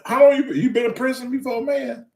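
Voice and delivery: high, worked-up demand; incredulous questioning. Retold or imitated. Beginner asking trainer about prison, incredulous. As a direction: blurt it out in high-pitched disbelief.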